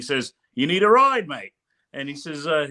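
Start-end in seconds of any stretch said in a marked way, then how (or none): 0.5-1.5 put-on higher, louder Australian accent. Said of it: "is that how my accent sounds".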